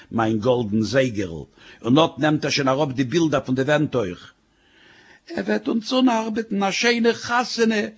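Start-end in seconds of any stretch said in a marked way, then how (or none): 5.3-7.9 high, agitated wail; fluttering wife. Acted. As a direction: fret like a fluttering bird, way up high. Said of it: "macht sie und flattert wie ein Vogel".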